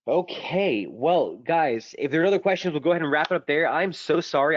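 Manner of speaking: a bit hoarse, losing his voice. Real, not acted. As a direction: talk through a tickly, coarse throat. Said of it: "I apologize if I sound a little coarse".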